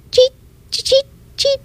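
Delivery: very high squeaky cheeps; cricket chirp. A narrator's reading. The cricket singing at night, very high chirps. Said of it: "From the cricket".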